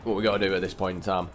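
ordinary talk, nothing marked

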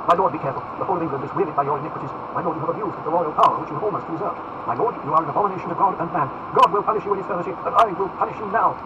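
impassioned accusation climbing in pitch and force; increasingly excited. Acted. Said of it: "becoming more excited as he spoke".

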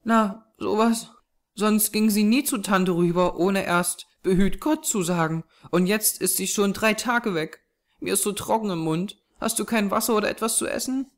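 put-on high child's voice, puzzled; plaintive little girl. A narrator's reading. Thirsty small girl to the man, puzzled and plaintive. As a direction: chatter in a small girl's high voice.

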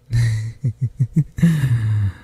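long giggle easing off; drawn-out amused chuckle; fond chuckle. Acted. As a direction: laugh fondly at the answer. Hearing the answer.

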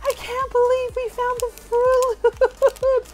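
loud, high, near-crying joy; ecstatic shouting. Real, not acted. Celebrating the new piece to her viewers, loud high joy. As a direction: shout with pure delight.

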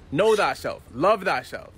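ordinary talk, nothing marked

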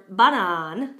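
"banan" with stressed full "ba" and long second "a"; light stress on "ba", stretch "nan" in "banan"; Czech long vowel. Shown as a demonstration. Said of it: "still a stress on that ba".

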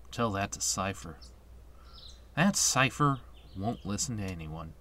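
low, quiet grumble; sullen game hero. Acted. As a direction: answer back under your breath, resentful. Voices Squall's sullen answer.

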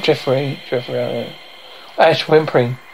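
ordinary talk

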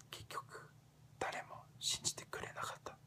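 hushed Japanese whisper; whispered confession. Shown as a demonstration. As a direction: drop to a whisper, as if confiding. Confiding failure in Japanese.

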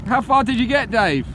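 loud call across the water. Real, not acted. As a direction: shout it to the boat coming in. Calling out to approaching boat.

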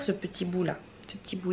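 tender murmur. Real, not acted. Cooing at the kitten on her lap.

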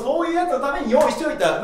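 usual speaking voice

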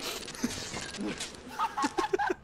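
high silent wheeze; wheezing laugh. Real, not acted. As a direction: laugh breathlessly. Laugh after joke.

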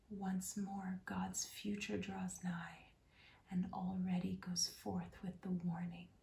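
usual speaking voice

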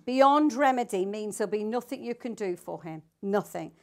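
ordinary talk, nothing marked

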